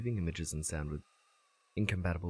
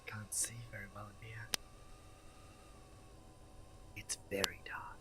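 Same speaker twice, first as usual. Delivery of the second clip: hushed sneaking whisper; 15 dB quieter than usual. Acted. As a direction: breathe the words, sneaking around. Sneaking through a dark house.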